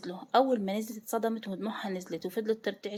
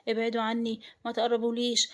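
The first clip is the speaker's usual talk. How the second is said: usual speaking voice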